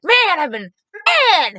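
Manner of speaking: imitated high, loud "man" of amazement; thrilled exclaiming. Supporter lost for words before the candidate, thrilled. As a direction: burst out like an overexcited fan.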